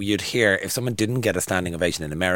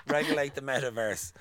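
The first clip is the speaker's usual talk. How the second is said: laughing; 4 semitones higher, 7 dB quieter than usual. Acted.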